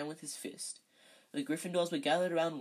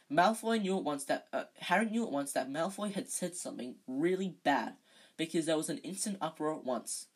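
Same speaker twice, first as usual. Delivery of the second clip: usual speaking voice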